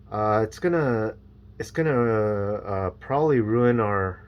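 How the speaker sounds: ordinary talk, nothing marked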